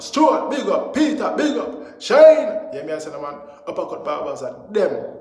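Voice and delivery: loud, pumped-up barbershop advert shout-out; loud hype ad read. Acted. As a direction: sell the barber shop like a radio ad.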